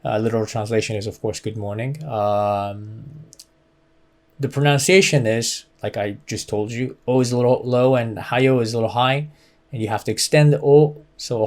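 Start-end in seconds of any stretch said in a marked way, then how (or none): none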